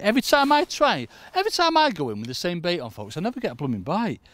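high, loud comic complaint; loud, exasperated grumbling; exasperated moan. Real, not acted. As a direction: protest it with pitch shooting up. Comic moan about never getting bites.

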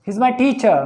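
normal talking voice

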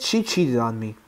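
ordinary talk, nothing marked

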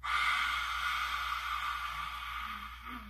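long, soft unvoiced breath out; long dragon breath. Shown as a demonstration. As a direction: breathe out slowly like a dragon's fire. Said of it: "Like this".

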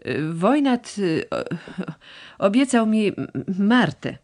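halting, stammering with nervous fillers. A narrator's reading. Young suitor confessing to his rival, stammering nervously. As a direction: stumble over every word, too shy to say it. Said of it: "jąkając się rzekł".

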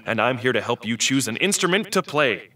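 usual speaking voice

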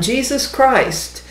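ordinary talk

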